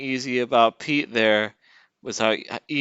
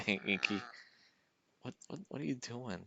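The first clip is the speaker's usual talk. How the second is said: ordinary talk, nothing marked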